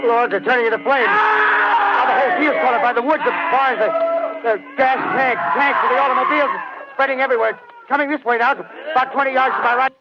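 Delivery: high, panicked shout, dragged out at first; terrified shouting. Acted. Field reporter on air sees the heat ray burn men, terrified shouting. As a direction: scream in horror as the fire spreads toward you.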